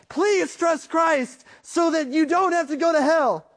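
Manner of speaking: high, urgent panicked plea; frantic screaming warning. Pretend. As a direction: shout a warning just back from hell. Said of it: "he started screaming".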